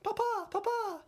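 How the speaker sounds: very high, squeaky needy son voice; Ramsay impression. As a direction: pitch it way up like a whiny little boy begging his dad. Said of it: "that's my impression of Ramsay".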